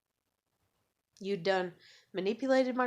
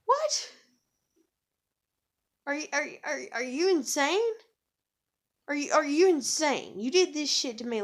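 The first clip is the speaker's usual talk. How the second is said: higher, louder, stammering disbelief; incredulous outrage; 6 semitones higher, 5 dB louder than usual. Retold or imitated. Retelling a fight with the ex, incredulous. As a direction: throw it back at them, stumbling over repeats in outrage. Retold quarrel with her ex.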